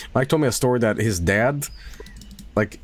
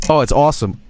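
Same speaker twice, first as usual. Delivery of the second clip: louder, higher mock-cheerful dad; 4 semitones higher, 7 dB louder, faster than usual. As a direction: play the dad forcing a compliment, loudly. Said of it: "And he's like".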